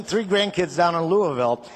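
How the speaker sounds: ordinary talk, nothing marked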